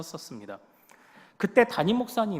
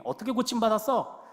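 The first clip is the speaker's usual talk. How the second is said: acted higher, louder interrogation; accusing; 5 semitones higher, 5 dB louder than usual. Pharisees grilling the healed blind man, accusing. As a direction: scold like an indignant accuser. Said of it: "헐뜯고 있는 그때에".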